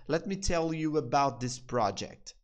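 slow, careful repeat; slow demo. Shown as a demonstration. Teacher repeating the example for students, slow. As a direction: repeat it slowly. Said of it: "Deixa eu te falar sobre esse projeto".

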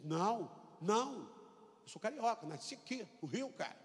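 a man who swears he's from Rio; insistent carioca. Retold or imitated.

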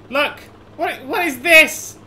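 high, loud and slowed in disbelief; startled. Real, not acted. Player spotting bizarre roads, loud high disbelief. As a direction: gasp it out at the strange sight. Crashing into weird game roads.

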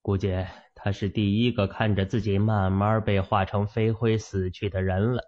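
plain reading voice

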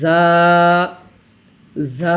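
"যা" with a soft, buzzing z and long aa; soft buzzing sound. Shown as a demonstration. Teacher demonstrating the letter, soft buzzing "যা". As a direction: let "যা" buzz softly at the start. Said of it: "ঝিরঝির ও নরম আওয়াজে".

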